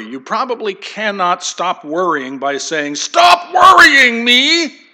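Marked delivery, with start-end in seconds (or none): 3.0-4.8 a slow, much higher and louder mock yell; hammed-up shouted order to himself. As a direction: bellow it at your own worry. Mock command to stop worrying.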